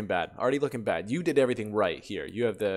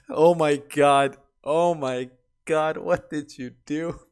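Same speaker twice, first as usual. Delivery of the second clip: laughing disbelief; 5 semitones higher, 8 dB louder, slower than usual. Real, not acted. Cracking up at hose story.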